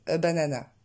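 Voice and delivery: BANANA with three equal full "a" syllables; unstressed mispronunciation. Shown as a demonstration. Teacher quoting what learners might say, French-style banana. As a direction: say BANANA the French way, evenly weighted. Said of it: "même si vous dites".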